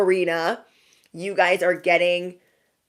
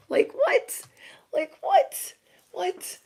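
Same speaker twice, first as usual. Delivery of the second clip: a breathy, much higher squeak of disbelief; squeaky shock; 12 semitones higher, much slower than usual. Real, not acted. Shocked reaction to the cards.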